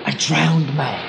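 low, slow, drawn-out reveal; slow and low, for suspense; dramatic reveal. Acted. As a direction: stretch it out like a sinister showman. Showman reveals his attraction after pause.

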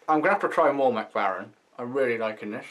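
talking around pipe stem; pipe in mouth. Real, not acted. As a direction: lips clenched on pipe. Seen 0: Pipe stem held between lips throughout the labelled words.

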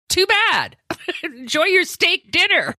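high, laughing brush-off; laughing mock dismissal. Mother brushing off her grown son, laughing high brush-off. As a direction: brush him off through a laugh. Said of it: "And I was like".

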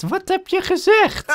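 high, drawn-out shocked question; incredulous outburst. Real, not acted. Player reacting to what Mattie said, incredulous. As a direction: demand it in high-pitched disbelief.

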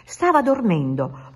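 plain reading voice

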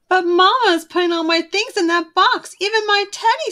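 high, loud plea, pitch climbing; a cub pleading to save her teddy; upset little Sister. A narrator's reading. Sister protesting to Papa about her teddy, high pleading cry. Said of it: "said Sister".

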